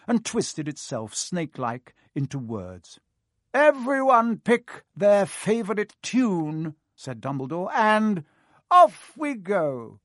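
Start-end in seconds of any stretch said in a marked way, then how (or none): 3.5-10.0 high, slow, grandfatherly announcing; an old headmaster calling the hall to order; Dumbledore voice. Acted.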